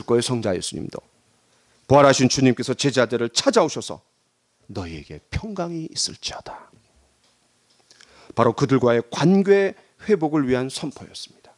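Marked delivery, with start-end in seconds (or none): 4.7-6.7 hushed, low pronouncement of peace; almost whisper the greeting kindly; very soft, solemn reassurance; tender blessing. Acted. Risen Lord greets disciples gently.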